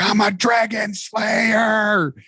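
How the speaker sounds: a rallying war cry as she charges the fire; retelling the trainee's triumphant scream; screamed battle cry. Retold or imitated. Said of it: "you just screamed out".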